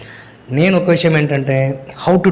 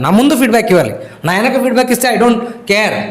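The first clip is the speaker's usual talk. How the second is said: higher, forceful point-making; emphatic lecture; 8 semitones higher than usual. Real, not acted. Teacher lectures student on feedback, emphatic. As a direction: drive the point home to students.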